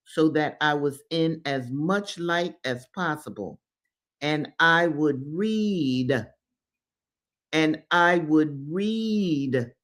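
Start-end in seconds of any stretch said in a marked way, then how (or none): 4.2-6.3 slow emphatic. Real, not acted. Repeated reading for emphasis. 7.5-9.7 slow emphatic. Real, not acted. Repeated reading for emphasis.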